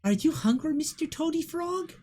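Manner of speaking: put-on high child voice talking to a pet; high, lilting little girl. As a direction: ask sweetly, pitched high and rising. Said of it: "pull out a little cricket and go".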